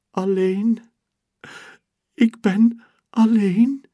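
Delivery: high, loud, sniffly lament; a lonely girl's sniffly complaint; sniffling. Acted. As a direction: sniff through it like about to cry. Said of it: "snift ze".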